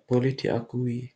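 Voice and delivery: ordinary talk, nothing marked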